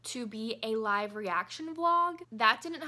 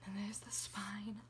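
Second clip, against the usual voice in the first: whisper; 3 semitones lower, 10 dB quieter than usual. Real, not acted. Hushed look at the book.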